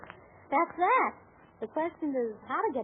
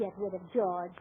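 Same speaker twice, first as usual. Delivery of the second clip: ordinary delivery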